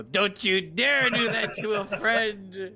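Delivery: high, loud, slow warning through bared teeth; angry threat. Acted. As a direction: growl a furious warning at the captain. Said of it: "and I go".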